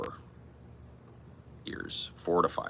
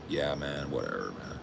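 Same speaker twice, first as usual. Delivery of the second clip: lower, softer mopey brush-off; sad drunk mumble; 3 semitones lower, 7 dB quieter than usual. Retold or imitated. Sad drunk [name] waving off a friend, low mopey mumble. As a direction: mumble it off, dejected. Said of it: "I'm like".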